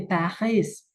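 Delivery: Paris with a French throaty r and no s, pa-hee. Shown as a demonstration. Teacher gives the native French way to say Paris. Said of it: "orang aslinya membacanya seperti itu".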